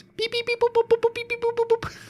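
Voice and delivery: high robotic beep boop; squeaky alien beeps; beeping noises. Retold or imitated. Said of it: "all they heard was".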